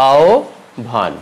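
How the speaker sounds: আহ্বান drawn out as "ao... bhan"; slow correct pronunciation. Shown as a demonstration. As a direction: slowly split আহ্বান before bh. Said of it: "হবে".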